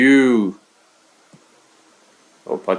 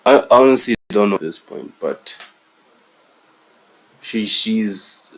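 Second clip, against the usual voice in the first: ordinary delivery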